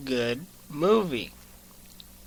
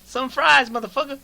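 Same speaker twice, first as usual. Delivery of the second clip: high, loud start, hammed up; mock catchphrase; 11 semitones higher, 7 dB louder than usual. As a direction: throw it out as a movie tough-guy line. Riffing on actor's catchphrase.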